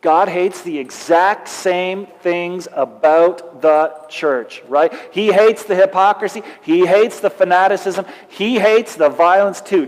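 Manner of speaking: usual speaking voice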